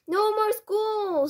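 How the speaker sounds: high, loud, drawn-out cheer; gleeful boy. A narrator's reading. Mouse boy celebrates no school, gleeful cheer. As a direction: crow it slowly in a high voice. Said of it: "grinning a broad and a shine".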